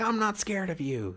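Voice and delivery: high-pitched mimicry of a kid; little kid. Little kid sizing up a man in line, high mocking imitation. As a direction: imitate a defiant child in a high voice. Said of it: "there was this little kid".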